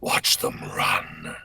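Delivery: slow, low dark voice; Omen voice line. Acted. Sharing a favourite line with chat, slow dark. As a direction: savour it darkly. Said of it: "there's one that I love".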